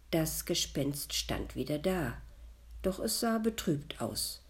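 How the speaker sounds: ordinary delivery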